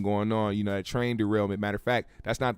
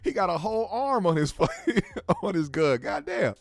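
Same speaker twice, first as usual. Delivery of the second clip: much higher, laughing in disbelief; 9 semitones higher than usual. Real, not acted. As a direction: crack up at the sight, voice jumping high. Laughing at a photo of a gun.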